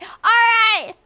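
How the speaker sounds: hammed-up school master scolding; discipline master yell. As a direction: play the strict master shouting at assembly. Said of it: "the discipline master is like".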